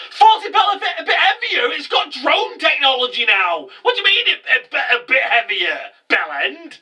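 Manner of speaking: high, incredulous shouted echo; outraged disbelief. Real, not acted. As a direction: throw it back in exasperated, high-pitched disbelief. Outraged echo of the mild quote.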